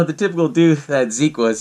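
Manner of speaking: normal talking voice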